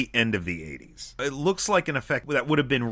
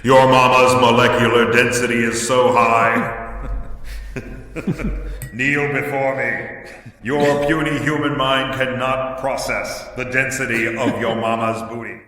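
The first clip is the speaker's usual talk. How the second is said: booming cosmic guardian voice; dark lord; 5 dB louder than usual. Pretend. Guardian yo mama joke impression.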